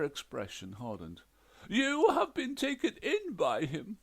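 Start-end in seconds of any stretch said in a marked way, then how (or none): 1.7-4.0 high, loud reproach; scolding ghost wife. A narrator's reading. Ghost wife scolds the detective, high and loud.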